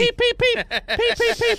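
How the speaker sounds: high, loud chirping peeps; baby chick peeps. Pretend. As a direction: peep like a hungry baby bird. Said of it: "like a little baby bird".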